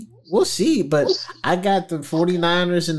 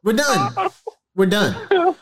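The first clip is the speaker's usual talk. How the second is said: high-pitched, laughing insistence; mock-exasperated and high; playful protest; 7 semitones higher, faster than usual. Real, not acted. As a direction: shut it down in a raised, amused voice. Playfully shutting down co-host's argument.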